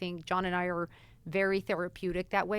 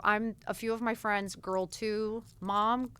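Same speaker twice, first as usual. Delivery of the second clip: normal talking voice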